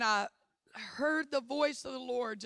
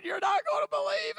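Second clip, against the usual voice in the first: much higher, fast, giddy rush; bursting to share the secret; breathless excitement; 14 semitones higher, 3 dB louder than usual. Retold or imitated. Woman confides in her friend, high and excited. Telling Robin her calling.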